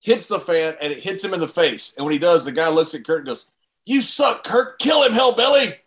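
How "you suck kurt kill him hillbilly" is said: imitating an angry fan; fan yelling. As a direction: yell from the stands at the heel. Fan turns and yells.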